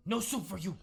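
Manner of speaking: Soup Nazi quote. Retold or imitated. Podcaster quoting Seinfeld's Soup Nazi, lightly. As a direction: bark it like a gruff soup vendor, lightly. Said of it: "I love the soup episode".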